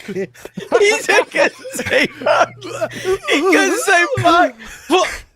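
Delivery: laughing so hard the words stretch out; helpless laughter. Real, not acted. Co-host laughing at the hiccuping host, crying with laughter.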